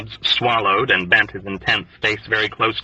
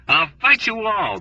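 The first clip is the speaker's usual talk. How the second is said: screamed defiance; 10 semitones higher than usual. A narrator's reading. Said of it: "Dobbs screamed back at them".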